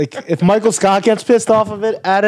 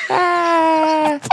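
put-on baby cry; 9 semitones higher than usual. Shown as a demonstration. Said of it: "baby voice".

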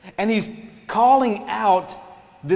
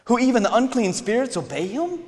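astonished crowd; 6 semitones higher than usual. Retold or imitated. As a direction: gasp it out like a stunned onlooker. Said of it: "and they're like".

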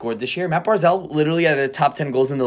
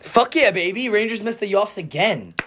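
high, gloating cheer over the rival; mock celebration; 9 semitones higher than usual. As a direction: crow it like a rival fan. Mocking celebration of Rangers missing.

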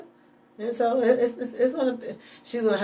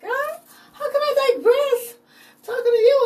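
much higher, amazed and half laughing; surprised at himself. Retold or imitated. Speaker quoting himself asking God, high and astonished. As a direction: catch yourself mid-prayer, amazed. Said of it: "surprised when I caught myself, like".

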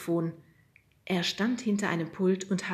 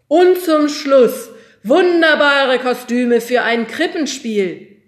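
loud, slow, high showman pitch; auctioneer call; 7 semitones higher, 13 dB louder, slower than usual. A narrator's reading. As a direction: present the last lot grandly. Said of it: "rief der Auktionator".

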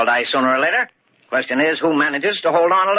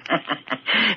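soft chuckle; 5 semitones higher, 5 dB quieter than usual. Acted. Old man amused at police search.

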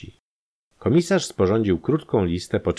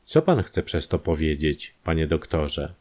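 ordinary delivery, nothing marked

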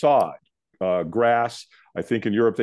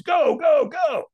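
high, shouted launch command; urgent shouting; much slower than usual. Retold or imitated. Trainer sending the firewalker onto the coals, urgent shouting. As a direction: yell it to send someone off running.

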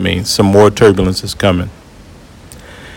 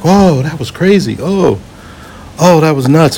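higher-pitched imitation of relieved passengers; relieved exclamation; 7 semitones higher than usual. Passengers to each other after the turbulence stops, imitated relief. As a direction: act out a crowd exhaling in relief.

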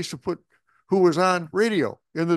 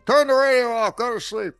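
raised, higher stern dad order; father's order; 10 semitones higher, 5 dB louder than usual. Retold or imitated. Father ordering his son to sleep, loud and stern. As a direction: bark it like a dad at bedtime. Father scolding kid at night.

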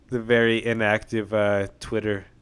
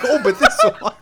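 imitating; 12 semitones higher, 3 dB louder than usual. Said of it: "He's like".

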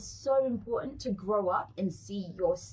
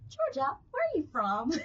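lightly voiced prim little girl, higher; a hint of a posh child asking; proper little girl; 8 semitones higher than usual. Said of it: "she speaks so proper".

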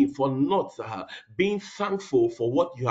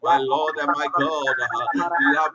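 fervent prayer. Real, not acted. Pastor crying out for mercy over the church. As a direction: beg for mercy in full voice.

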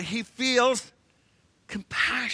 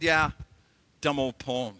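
deflated; 6 semitones lower than usual. Retold or imitated. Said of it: "Oh, my goodness".

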